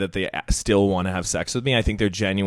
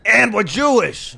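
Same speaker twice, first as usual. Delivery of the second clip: put-on Brooklyn accent; 12 semitones higher, 6 dB louder, slower than usual. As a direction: belt it out in a thick Brooklyn accent. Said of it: "it was a Brooklyn accent".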